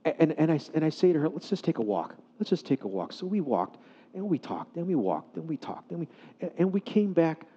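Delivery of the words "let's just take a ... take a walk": soft, low, soothing suggestion; quietly steer someone away from a scene; gentle coaxing. Retold or imitated. Calming the humiliated wife.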